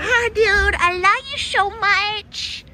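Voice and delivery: high, slow cooing; baby talk to a pet. Acted. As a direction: coo sweetly to the dog. Cooing at the dog.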